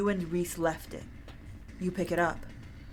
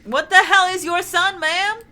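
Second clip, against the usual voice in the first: loud high outburst; 13 semitones higher, 11 dB louder than usual. Acted. Player character outburst.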